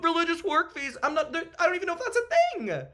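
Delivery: about to tear up, fighting it; close to tears. Real, not acted. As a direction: voice high and strained, near tears. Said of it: "he was about to tear up a little bit there".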